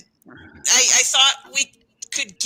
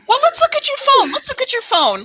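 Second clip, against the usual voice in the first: nosy eagerness; 11 semitones higher, faster than usual. Acted. Snooping through roommate's phone.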